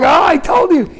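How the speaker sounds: high-pitched told-you-so; exasperated vindication. Retold or imitated. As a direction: protest that you were right all along. Frantic husband vindicated by nurse.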